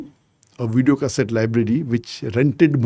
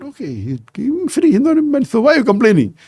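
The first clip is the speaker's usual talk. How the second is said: retold retort, pitched well higher, indignant; exasperated retort; 10 semitones higher than usual. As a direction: throw it back at the complainers in disbelief. Retold retort to complaining people.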